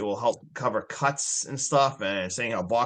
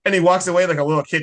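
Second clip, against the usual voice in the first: excited; 6 semitones higher, 7 dB louder, much faster than usual. Real, not acted. Lively retelling of tantrum.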